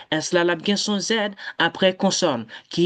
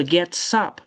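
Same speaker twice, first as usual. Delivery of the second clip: ordinary delivery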